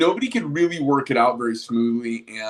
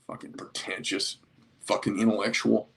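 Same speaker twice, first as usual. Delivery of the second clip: muttered aside; 6 semitones lower, 6 dB quieter than usual. Real, not acted. Muttered aside about Elon.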